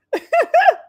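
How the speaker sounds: a sudden high shriek of a laugh; high squeal of laughter. Real, not acted. Streamer reading a brutal chat comment, squealing laugh.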